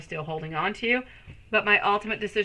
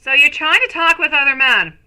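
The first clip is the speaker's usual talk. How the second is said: higher and much louder, thrilled; excited; 8 semitones higher, 12 dB louder than usual. Real, not acted. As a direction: blurt the question with gleeful energy. Excitedly reading out a card message.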